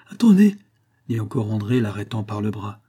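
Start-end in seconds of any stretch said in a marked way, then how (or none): 0.1-0.6 high, loud plea to wait; urgent call. Acted. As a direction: stop someone before they run off. Stops him as he leaves.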